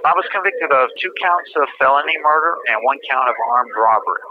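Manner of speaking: usual speaking voice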